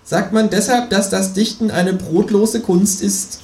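high kangaroo voice; high-voiced talking kangaroo; kangaroo character. A narrator's reading. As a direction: play the kangaroo, pitched high. Kangaroo continues the dialogue.

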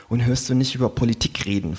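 ordinary delivery

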